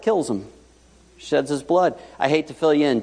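normal talking voice

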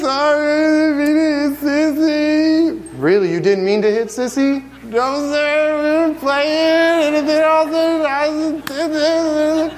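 put-on high child's voice, slow and sorry; a small boy owning up, high and timid; little boy voice. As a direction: play the little son apologizing to dad. Son Liam voiced, apologizing.

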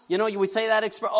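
usual speaking voice

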